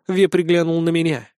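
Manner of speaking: usual speaking voice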